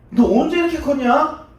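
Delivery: a hint of elders talking down to a boy; lightly voiced villagers chiding young Jesus; townsfolk scolding a child. Hometown elders treating Jesus like a child, mild scolding. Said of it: "어린아이 취급을 한 거예요".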